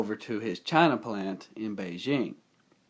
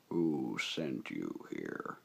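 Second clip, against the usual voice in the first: much lower, slow menacing robot voice; deep robot villain; 9 semitones lower, 9 dB quieter, much slower than usual. Acted. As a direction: growl it like a giant machine villain. Voicing Transformer villain's line.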